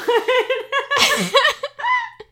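loud, high peals of laughter; helpless laughter. Real, not acted. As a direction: lose it completely over the joke. Still cracking up at joke.

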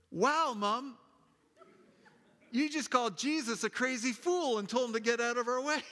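put-on high, gleeful kid's voice; son teasing. Son telling mom she called Jesus a fool, gleeful. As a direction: rub it in, gleeful. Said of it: "her son yells out".

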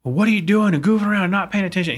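high, fast scolding shout at his son; mock angry yell at his son; retold angry yell. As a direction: snap at the kid like a startled dad. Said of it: "screaming at him".

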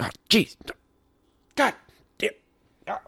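high, pained broadcaster groan; Ron Santo on-air groan. Retold or imitated. Radio announcer groans at blown inning, high pained. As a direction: mimic the anguished radio man. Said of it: "you'd really be able to hear it".

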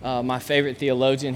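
usual speaking voice

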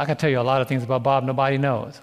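normal talking voice

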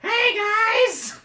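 very high, loud squeaky mock dwarf; joke dwarf voice. Game master spoofing a dwarf for protesting players, joke dwarf voice. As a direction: shriek a silly greeting as a fake dwarf. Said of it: "That's not even your dwarf voice".

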